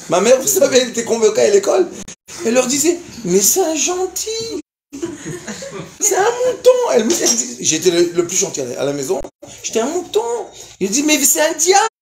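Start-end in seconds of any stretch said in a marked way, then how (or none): 3.2-6.7 lightly voiced mother insisting he is gentle; mock doting mother. Mother defends son to teacher. 9.6-10.5 lightly voiced mother insisting he is gentle; mock doting mother. Mother's claim retold. 11.0-11.9 high, loud, accusing cry; hammed-up teacher insisting on devil; mock teacher retort.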